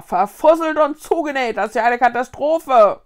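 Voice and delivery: loud, exasperated outburst; calling out, appalled. A narrator's reading. Ghost grandpa reacts to the catastrophe, crying out. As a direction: curse loudly at the disaster. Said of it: "rief der Geisteroper".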